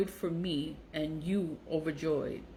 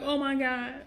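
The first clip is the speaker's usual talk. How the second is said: higher mocking oh my God; mock shock; 7 semitones higher than usual. Daughter mimicking her mother's fake gasp, mock-shocked. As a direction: play her faking shock behind her hand. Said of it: "she covering her mouth".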